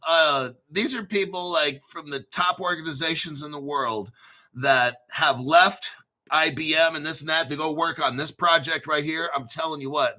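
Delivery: ordinary delivery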